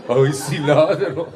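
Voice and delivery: slow, high mock wail; hammed-up sheepish realization; comic self-recognition. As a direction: drag it out high in mock dismay. Comic imagined listener realizing.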